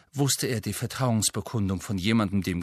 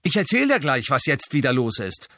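higher and louder, biting; angry retort; 4 semitones higher, 5 dB louder than usual. A narrator's reading. Angelina snapping back at tired Harry, angry. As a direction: throw his words back at him. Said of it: "die offenbar ziemlich wütend war".

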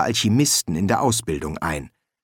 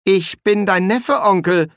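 high, loud, aggrieved protest; plaintive objection at raised pitch; 9 semitones higher, 4 dB louder than usual. Acted. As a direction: object as the wronged nephew. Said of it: "protestierte er".

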